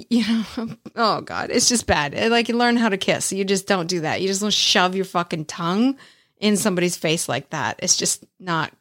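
normal talking voice

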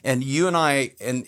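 ordinary talk, nothing marked

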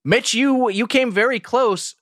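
high, bright host announcing; game show host. Acted.

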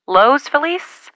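Feliz said slowly as fə-LEES, high rising; Los Feliz questioned. Shown as a demonstration. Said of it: "Francis always said Los Feliz".

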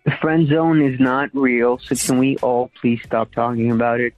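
normal talking voice

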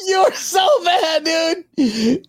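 high-pitched, drawn-out scolding in jest; amused outcry. Real, not acted. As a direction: cry it out high and stretch it, scandalized but amused. Teasing outburst at co-host's joke.

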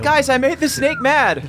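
high, gleeful announcement; excited squeal. Real, not acted. Player telling the group she angered the snake, high gleeful squeal. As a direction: squeal it to the whole table.

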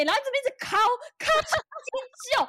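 worked-up retelling. Retold or imitated. Retelling painful scraping.

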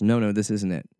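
usual speaking voice

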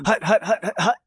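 sharp high barked hut calls; quarterback calling cadence. Retold or imitated. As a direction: call the snap like a quarterback. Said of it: "you're like".

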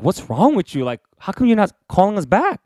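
high, puzzled friends asking why he vanished; a hint of a baffled schoolmate. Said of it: "they're like".

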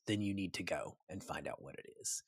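low, very soft kingly counsel; king's reply. Acted. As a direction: drop down low and quiet, as the king. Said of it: "And he says".

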